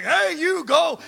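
loud bold command; commanding shout. Retold or imitated. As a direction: order it out with full authority. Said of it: "And I was like".

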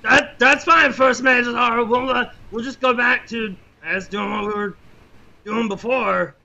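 put-on high, loud young boy's voice; eager cabin boy. Cabin boy answers the first mate, high and eager. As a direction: chirp back eagerly like a kid.

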